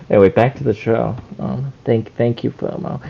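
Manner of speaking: low, soft put-on detective voice, quick; deep detective muttering; Detective Koichi voice. Detective steering back to the show, low and quick. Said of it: "Detective Koichi".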